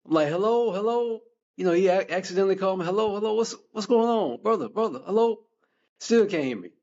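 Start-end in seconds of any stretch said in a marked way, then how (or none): 0.3-1.2 higher, repeated hellos, like nobody answers; mock calling hello into a phone. 2.9-5.4 higher, repeated hellos, like nobody answers; mock calling hello into a phone.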